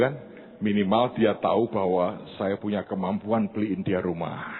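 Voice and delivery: ordinary talk, nothing marked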